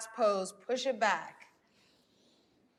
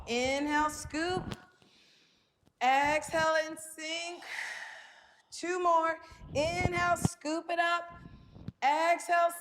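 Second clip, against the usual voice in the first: higher, steady breath cues; yoga cueing; 6 semitones higher than usual. Acted. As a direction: guide the stretch, counting breaths.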